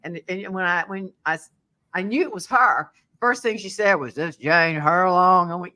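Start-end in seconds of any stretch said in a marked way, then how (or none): 4.1-5.5 slow, gravelly Southern smoker voice. Retold or imitated. Renter phones the landlady, slow gravelly smoker voice. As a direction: rasp it like a tough chain-smoking Southern woman. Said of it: "that gravelly smoker voice".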